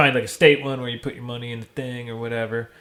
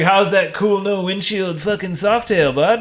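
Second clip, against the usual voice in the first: teasing dad; 7 semitones higher, slower than usual. Retold or imitated. Dad teasing him in rain.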